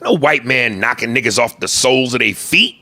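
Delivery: normal talking voice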